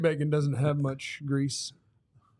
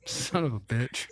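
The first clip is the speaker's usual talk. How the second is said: exasperated sigh; 3 semitones lower, 4 dB quieter, slower than usual. Real, not acted. Realizes he's been tricked.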